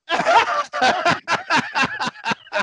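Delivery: loud, high cackling laugh; big burst of laughter. Real, not acted. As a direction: crack up hard. Punchline of the story.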